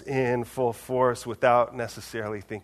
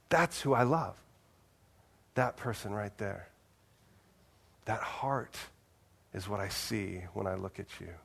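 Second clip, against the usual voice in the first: softer and lower, God gently naming what he loves; tender reassurance; 4 semitones lower, 8 dB quieter than usual. Retold or imitated. God to the listener, softly reassuring them of his love. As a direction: ease into it like a quiet blessing.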